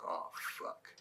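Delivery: breathless whispered curse; under your breath, dreading it. Retold or imitated. Dread at being called over.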